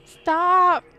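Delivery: high, loud panicked stop; frightened cries. Real, not acted. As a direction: cry out in fright. Game voice startles her.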